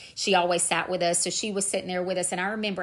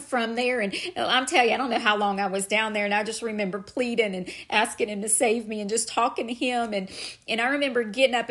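fervent testimony; 4 semitones higher than usual. Real, not acted. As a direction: pour it out like a preacher at the altar. Fervent testimony at full force.

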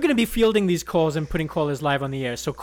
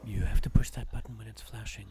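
whisper; 8 semitones lower, 6 dB quieter than usual. Real, not acted. Whispered aside off air.

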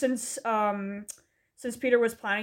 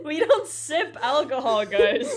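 higher, giggly delivery; laughing agreement; 4 semitones higher, slower than usual. Real, not acted. Friend backing up the no-sipping joke, laughing agreement.

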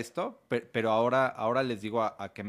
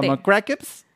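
put-on six-year-old boy; 7 semitones higher, 11 dB louder, slower than usual. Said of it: "¿estás seguro que tienes seis años".

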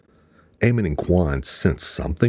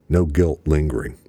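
plain reading voice

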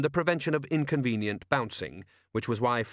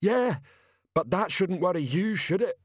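higher-pitched character voice for Detritus; Detritus, ponderous character; 8 semitones higher than usual. Acted. Detritus reassuring a worried companion, blunt character voice.

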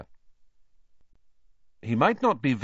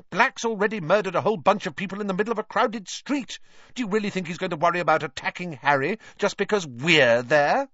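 exasperated; 11 semitones higher, 3 dB louder, faster than usual. A narrator's reading. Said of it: "snapped Hermione".

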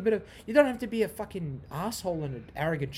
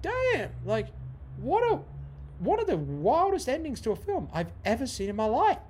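high, awed rave; high-pitched wow-I-can't-believe-it; amazed excitement; 8 semitones higher than usual. Retold or imitated. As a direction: gush about the ending, thrilled. Reacting to the wild ending.

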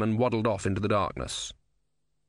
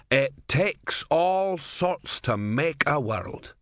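slow, homespun barman drawl; folksy barman; 5 semitones higher, much slower than usual. A narrator's reading. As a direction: savor the cliché slowly.

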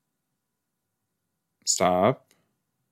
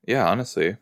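usual speaking voice

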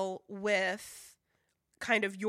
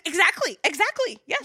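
excited agreement; 9 semitones higher, 9 dB louder, faster than usual. Real, not acted. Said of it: "eager agreement".

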